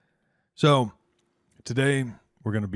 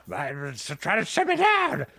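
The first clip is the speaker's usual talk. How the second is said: much higher, louder frantic ranting; paranoid screaming Herb; 13 semitones higher, 4 dB louder than usual. Acted. GM voicing Herb ranting behind the bar, a high frantic rant. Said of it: "He's back there screaming".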